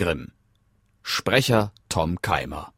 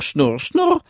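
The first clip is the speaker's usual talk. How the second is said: spinning wheel whirr; 9 semitones higher, 3 dB louder than usual. Acted. Spinnrad-Geräusch nachgeahmt.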